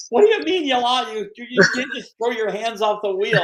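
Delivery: high-pitched retold outburst of disbelief; imitating a panicked, scolding friend; incredulous passenger. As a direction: demand an explanation, voice jumping way up. Retold outraged passenger after crash.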